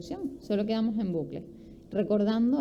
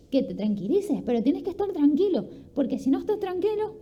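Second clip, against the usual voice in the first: higher and louder, pushy and tense; anxious nagging "tienes que estar tranquilo"; agitated urging to calm down; 6 semitones higher, 4 dB louder than usual. Shown as a demonstration. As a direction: push them to calm down, getting worked up yourself. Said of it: "si lo que hago yo es hablar con un tono mucho más suave".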